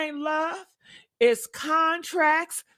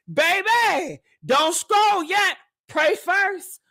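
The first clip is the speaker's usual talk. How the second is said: ancestors' warning; 6 semitones higher, 3 dB louder, slower than usual. Retold or imitated. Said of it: "the ancestors is just tapping on your shoulder saying".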